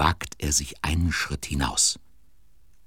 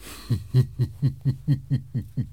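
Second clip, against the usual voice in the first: high giggle held back; suppressed chuckle; 10 semitones higher than usual. Acted. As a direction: laugh into yourself. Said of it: "Benjamin lacht in sich hinein". (unterdrücktes Kichern)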